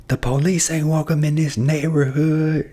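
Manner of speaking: high, drawn-out mock agitator's cry; riled-up agitator. Woman to the neighbors against police, imitated riling them up. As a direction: imitate her rousing the crowd, slow and high. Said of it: "She trying to get people riled up".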